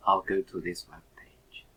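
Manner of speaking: ordinary delivery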